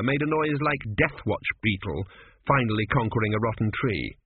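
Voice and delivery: ordinary delivery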